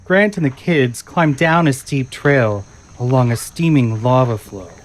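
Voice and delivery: normal talking voice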